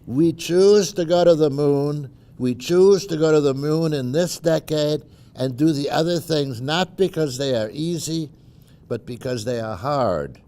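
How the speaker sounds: rally the crowd like a president at a podium; formal speech. Shown as a demonstration. Reading Kennedy Rice speech.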